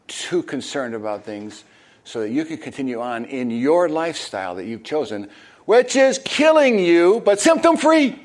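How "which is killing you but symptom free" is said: very high, loud sing-song mockery; squeaky sarcastic pitch; mock chirpy voice. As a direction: sell the deadly lifestyle like a cheerful ad. Mocking the symptom-relief mindset.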